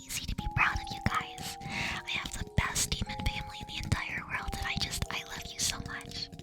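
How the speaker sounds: close-mic whispered ASMR, soft and tender. Acted. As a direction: murmur tingles right into the mic.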